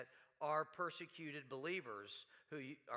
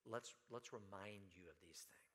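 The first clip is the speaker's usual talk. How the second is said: hushed, breathy whisper of the quote; whispered reminder; 9 semitones lower, 13 dB quieter than usual. Acted. Preacher whispering James's reminder to the rich. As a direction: lean in and whisper it.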